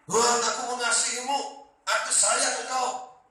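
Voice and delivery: loud, high, impassioned vow of loyalty; Peter boasting. Acted. Peter swearing to defend Jesus, loud bravado. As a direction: declare devotion at full volume, pitched high. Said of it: "Petrus juga gitu dulu".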